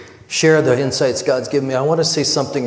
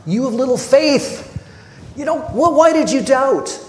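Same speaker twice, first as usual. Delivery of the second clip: a higher, sharper imitation of a frustrated rebuke; mock frustrated rebuke, raised pitch; mock angry Jesus; 9 semitones higher than usual. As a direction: play the angry reading as a hint of exasperation. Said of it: "hear it as quite angry".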